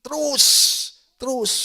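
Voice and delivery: high, loud one-word urgings; punchy preacher's shout; rousing exhortation. Real, not acted. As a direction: push them onward from the pulpit. Preacher urging congregation onward.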